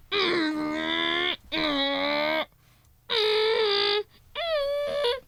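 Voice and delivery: imitated humming whine. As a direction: pretend motor noise stepping up in pitch. Imitated engine or motor noise.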